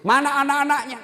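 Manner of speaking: high, loud retold question; startled demand. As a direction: demand to know, raising the voice. Ustaz kaget menanyakan anak janda. (pertanyaan kaget dengan suara tinggi)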